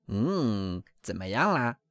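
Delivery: put-on a different, older duck voice; old duck dropping by to ask; old visiting duck. A narrator's reading. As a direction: drop into a nosy old duck asking. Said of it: "一只来串门的老鸭问道".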